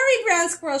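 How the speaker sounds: loud high urgent call. Acted. Grandmother calling Squirrel home, loud urgent call. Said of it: "she called".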